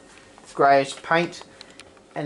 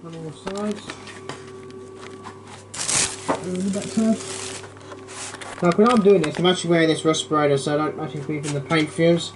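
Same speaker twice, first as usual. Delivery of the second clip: muffled behind a respirator mask; talking through a paint respirator; 3 dB quieter than usual. Real, not acted. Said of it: "Hope you can hear me through the respirator again".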